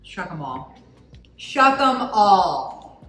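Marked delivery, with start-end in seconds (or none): 1.4-3.0 slow, loud, drawn-out battle cry; dramatic proclamation. Pretend. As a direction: proclaim it like a Game of Thrones king. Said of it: "Shuck 'em all".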